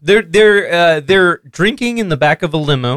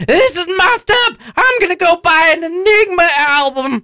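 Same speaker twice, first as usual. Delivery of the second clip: put-on much higher dopey man-child voice; Ralph voice; 11 semitones higher than usual. [name] as Ralph, brushing off his dad in a high dopey voice. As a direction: whine it like a clueless slacker. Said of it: "my Ralph voice".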